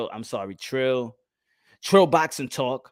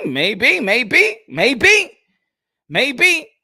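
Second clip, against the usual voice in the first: teasing maybe; 8 semitones higher, 7 dB louder, much slower than usual. Real, not acted. Teasing repeated maybe, rising.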